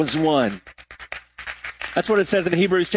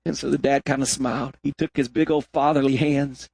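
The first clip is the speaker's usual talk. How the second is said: ordinary delivery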